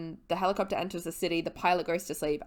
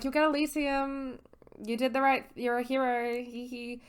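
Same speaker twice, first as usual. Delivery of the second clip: higher, lightly voiced teasing girl; mock sweet Annabeth; 6 semitones higher than usual. Annabeth taunting dying Luke, mock sweet. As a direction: coo it with a fake sweetness, then giggle.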